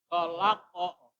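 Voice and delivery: slow "Kolako" with long middle and bounced q; end-of-verse stop. Shown as a demonstration. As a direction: stretch "Kolako", releasing the final sound briefly. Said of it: "Ya dibaca kayak gini".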